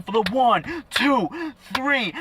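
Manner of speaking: dramatic retelling of a referee's count; excited pinfall count. Retold or imitated. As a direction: call the count with rising excitement.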